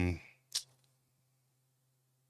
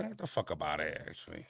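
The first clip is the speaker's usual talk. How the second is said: low, quieter brush-off; dismissive; 7 semitones lower, 7 dB quieter than usual. Real, not acted. To a corny caller, a low, quiet dismissal. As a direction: wave him off under your breath.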